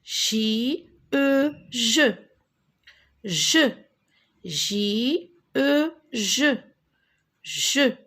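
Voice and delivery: J, E, then blended into "je"; teacher modelling "je" with pauses; spelling aloud. Shown as a demonstration. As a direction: sound out J and E separately, then say "je". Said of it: "est-ce que tu peux répéter".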